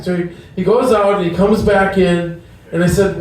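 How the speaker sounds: ordinary delivery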